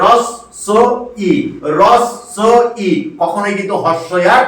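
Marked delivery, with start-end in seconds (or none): 0.1-1.3 "রস স ই" broken into three separate syllables; correct pronunciation. Shown as a demonstration. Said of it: "এর উচ্চারণ হয়ে যাবে". 1.8-2.9 "রস স ই" broken into three separate syllables; correct pronunciation. Shown as a demonstration. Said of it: "এর উচ্চারণ হয়ে যাবে".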